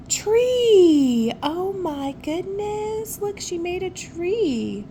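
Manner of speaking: high, loud, amazed shout, then slow; excited cry. Real, not acted. Reader reading to a child, amazed at the tree. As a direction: burst out in wonder at the tree.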